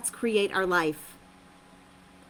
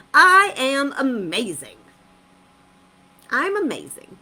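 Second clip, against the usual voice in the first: loud affirmation; 2 semitones higher, 7 dB louder than usual. Shown as a demonstration. Coach shows listeners how to say the affirmation, loud and proud. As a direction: belt out I am amazing with conviction. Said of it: "You can say to yourself".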